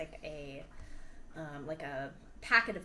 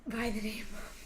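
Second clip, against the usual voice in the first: whispering; 2 semitones higher than usual. Acted. NPC trails off to a hush.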